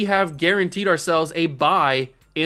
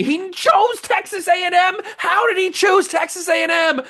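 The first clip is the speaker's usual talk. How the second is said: much higher, strained shriek of disbelief; outraged disbelief; 10 semitones higher than usual. Real, not acted. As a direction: squeal it like you can't believe the betrayal. Recruit picks rival school, outraged.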